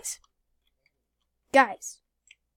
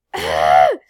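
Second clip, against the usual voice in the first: gasp; 12 dB louder than usual. Real, not acted. Sudden shock in game.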